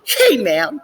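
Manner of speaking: high put-on dude voice; a loud high-pitched hey man; mock buddy greeting. Buddy greeting him again, high mock dude voice. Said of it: "Then he was like".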